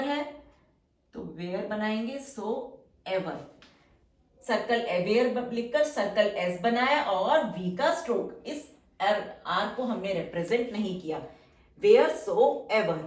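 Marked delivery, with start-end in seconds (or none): 2.2-3.4 stretched out syllable by syllable; slow dictation. Shown as a demonstration. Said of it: "teacher dictates word slowly". 11.8-13.0 each syllable stretched very slowly; slow dictation. Shown as a demonstration. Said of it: "teacher dictates word slowly".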